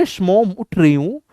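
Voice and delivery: a lightly voiced pampered bride; lazy daughter-in-law. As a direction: mumble the excuse from under the blanket. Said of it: "फिर बहू कहती है".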